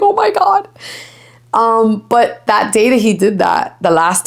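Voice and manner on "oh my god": high, startled oh my God; mock amazement at the dog under the bed; retold shock. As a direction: squeal it like you can't believe it. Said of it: "I was like".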